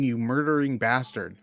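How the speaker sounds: usual speaking voice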